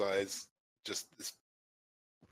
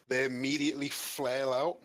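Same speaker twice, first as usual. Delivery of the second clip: ordinary delivery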